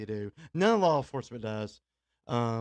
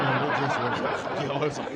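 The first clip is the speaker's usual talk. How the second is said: laughing; 4 dB louder than usual. Real, not acted. To listeners about the loud festival, laughing. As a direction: laugh while remembering.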